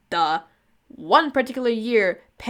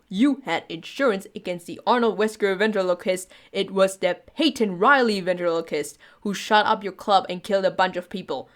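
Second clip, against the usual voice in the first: usual speaking voice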